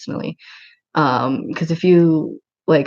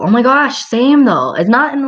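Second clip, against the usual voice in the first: excited; 7 semitones higher, 7 dB louder than usual. Real, not acted. Excited reply to the chat.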